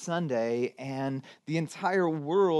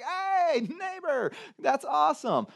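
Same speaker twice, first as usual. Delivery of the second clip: much higher, a thrilled hello to the neighbor; excited greeting; 10 semitones higher than usual. Pretend. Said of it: "You're like".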